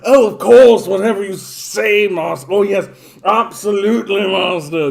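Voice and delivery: high, loud, fawning servant voice; Renfrew. Acted. Servant Renfrew sucking up to his master, high and loud. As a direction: grovel and gush to the master. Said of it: "this guy here".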